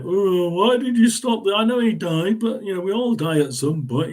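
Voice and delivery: complaining. Acted. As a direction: voice an aggrieved fan protesting. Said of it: "as the guy that everybody starts complaining".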